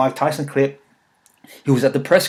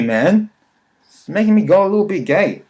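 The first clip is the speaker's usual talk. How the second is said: normal talking voice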